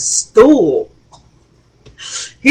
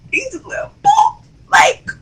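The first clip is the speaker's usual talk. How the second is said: squeaky mock voice. Mocking the small son.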